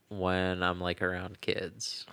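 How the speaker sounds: hushed, slow aside; whisper. Real, not acted. Drops voice mentioning kids.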